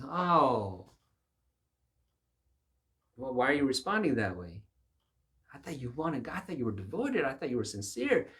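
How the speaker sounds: high, loud incredulous challenge; mock surprise. Preacher challenging the reluctant ruler, mock surprise. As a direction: call him out, astonished.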